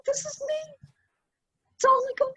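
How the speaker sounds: high, whispered through tears; a broken confession to her daughter; whispering it out while crying; tearful whisper. Retold or imitated. Crying at the lone tree.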